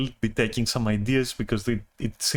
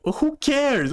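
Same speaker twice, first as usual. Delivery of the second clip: 1 incredulous; 11 semitones higher than usual. Retold or imitated. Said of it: "And I'm like".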